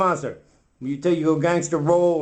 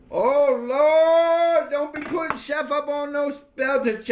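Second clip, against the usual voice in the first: dismayed exclamation; 13 semitones higher, slower than usual. Acted. Castmate objecting to Chef on a pedestal, dismayed outcry. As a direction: wail the opening, then object.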